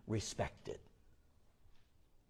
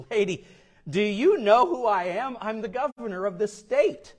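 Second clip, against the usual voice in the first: higher, louder, puffed-up demand; pompous governor; 9 semitones higher, 7 dB louder than usual. Retold or imitated. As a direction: throw your weight around as the governor. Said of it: "decides he's gonna throw his weight around".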